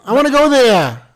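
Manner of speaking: loud, very high mock Boston kid, R dropped; hammed-up New York-Boston child yell; kids' New England accent. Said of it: "do your kids sound like that".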